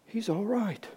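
high, soft astonished voice; amazed nurse. Acted. Nurse sees the boy start moving, hushed high amazement. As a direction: lift it high in quiet disbelief.

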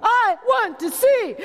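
high, slow, earnest cry; I want to see, pleaded high; pleading desire. Retold or imitated. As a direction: yearn to see. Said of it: "He said".

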